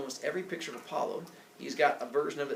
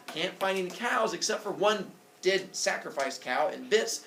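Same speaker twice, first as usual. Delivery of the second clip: plain reading voice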